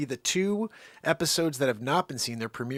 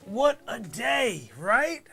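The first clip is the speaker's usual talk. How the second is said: high, slow, drawn-out greeting; mock-cheerful hello; upbeat greeting; 7 semitones higher, 6 dB louder, much slower than usual. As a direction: greet the friends with exaggerated cheer. Greeting friends on beach.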